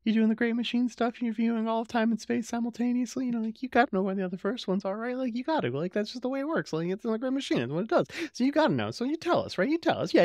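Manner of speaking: high, wheedling mock Delenn; Delenn's pleading voice. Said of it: "Delenn's all like".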